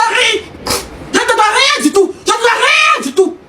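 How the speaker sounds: high, drawn-out protest; slow, high-pitched indignant insistence; indignant rebuttal. Real, not acted. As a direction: stretch the words out high and defiant. Heated rebuttal in an online quarrel.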